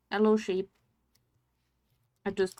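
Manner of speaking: usual speaking voice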